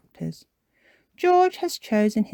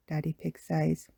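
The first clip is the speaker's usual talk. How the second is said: ordinary delivery, nothing marked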